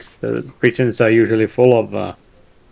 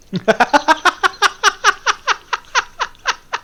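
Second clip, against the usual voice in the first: high burst of laughter. Real, not acted. Player cracks up at joke.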